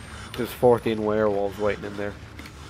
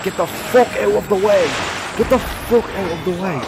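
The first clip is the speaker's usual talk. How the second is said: high frustrated shouts; frantic yelling; 6 semitones higher than usual. Real, not acted. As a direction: shove past in a panic. Blocked by enemy.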